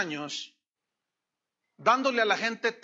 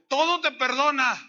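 higher, louder "Todo te perdona"; loud declaration; 6 semitones higher, 7 dB louder than usual. Retold or imitated. Preacher answering the gunman, loud and high. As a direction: proclaim forgiveness with force.

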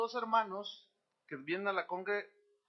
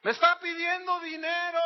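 loud, high-pitched public accusation; shouting out; 11 semitones higher, 8 dB louder than usual. Acted. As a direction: shout it out for everyone to hear. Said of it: "voy a gritar".

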